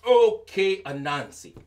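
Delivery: ordinary delivery, nothing marked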